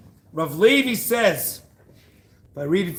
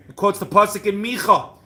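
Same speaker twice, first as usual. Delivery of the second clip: usual speaking voice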